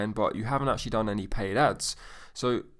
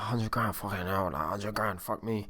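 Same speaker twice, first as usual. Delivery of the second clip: softer inner-voice disbelief; hushed aside to yourself; retold panicked thought; 7 dB quieter than usual. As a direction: recount a stunned thought under your breath. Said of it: "I'm like, panicking".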